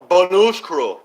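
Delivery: "bonushkru" drawn out slowly like a sneeze; a sneeze turned into the word "bonushkru"; mock sneeze word. Shown as a demonstration. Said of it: "it's spelled bonushkru".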